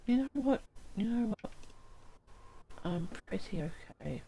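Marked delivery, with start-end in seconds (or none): 0.1-1.5 high and loud exclamation; excited. Acted. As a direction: burst out eagerly. Echoing the game character line.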